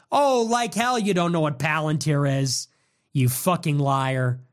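normal talking voice

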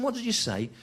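high, baffled query; high incredulous ask; baffled question. Retold or imitated. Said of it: "I said to him".

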